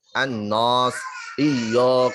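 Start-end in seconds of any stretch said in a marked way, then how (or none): 0.1-1.0 annas turned into annos with a dark o; wrong annos. Shown as a demonstration. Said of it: "jangan sampai".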